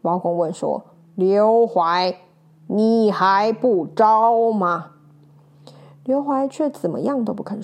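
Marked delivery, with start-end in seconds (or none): 1.1-4.9 louder, slow interrogation; stern demand. Acted. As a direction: press him hard to confess. Judge presses the prisoner.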